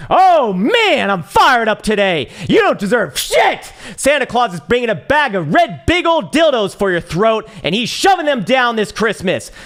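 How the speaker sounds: hammed-up Christmas rant shouted at greedy holders; fired-up shouting. Real, not acted. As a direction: yell it out, fired up and over the top. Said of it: "I'm just getting excited right now".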